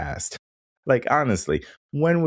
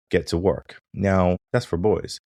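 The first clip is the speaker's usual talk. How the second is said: ordinary talk, nothing marked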